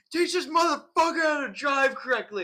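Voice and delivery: high, loud enraged shout; furious yell. Retold or imitated. Angry pedestrian yelling at the driving instructor, high loud shout. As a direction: scream it at the car window like a furious stranger. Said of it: "He goes".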